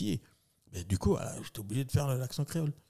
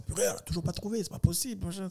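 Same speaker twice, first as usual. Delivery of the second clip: high, quick mock exasperation; exasperated director; 10 semitones higher, faster than usual. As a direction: imitate a director fretting under his breath, fast and high. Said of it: "le réal. Il sort". (réalisateur exaspéré, imité)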